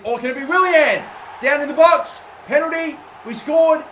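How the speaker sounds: high, loud goal commentary; excited shout. Real, not acted. Gamer calling his own attack live, excited shout. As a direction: hype the chance into a goal.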